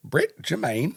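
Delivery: high-pitched put-on New Zealand accent; names said in mock New Zealand; lilting New Zealand attempt. Shown as a demonstration. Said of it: "I've gotta, like, listen to".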